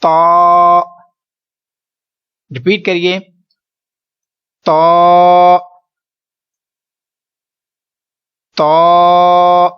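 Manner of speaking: تا held long and loud, full thick sound; heavy held letter. Shown as a demonstration. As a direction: recite تا heavy and drawn out. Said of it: "لمبا بھی کرنا ہے".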